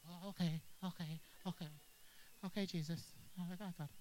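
mock chastened believer giving in; meek submission. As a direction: back down like a scolded kid. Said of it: "believer submitting after rebuke".

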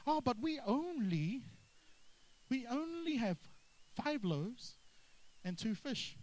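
mock whiny disciples clinging to the only. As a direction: drag out the only like a sulky helper. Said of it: "notice how they went straight to the only".

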